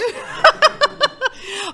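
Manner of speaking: high, loud laughing burst; helpless loud laugh; real laughter. Real, not acted. Laughing after the joke.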